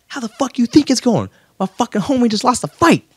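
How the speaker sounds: high, heated mock Diaz snap; angry retort. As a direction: throw it back at him, annoyed. Nick Diaz angry retort voiced.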